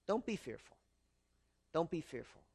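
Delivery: soft, low reassurance; God reassuring. Acted. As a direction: soothe gently, with unhurried pauses. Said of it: "And God says".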